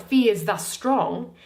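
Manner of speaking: normal talking voice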